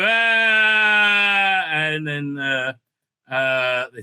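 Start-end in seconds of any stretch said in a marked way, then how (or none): 0.0-1.6 high loud hammed-up yell; mock villain cry. Villain being stopped, a hammed-up loud cry. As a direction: cry out like a cartoon baddie being caught.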